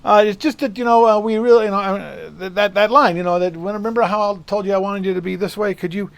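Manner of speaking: put-on nervous young engineer. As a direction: stammer out the note to a star. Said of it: "the poor kid, he goes".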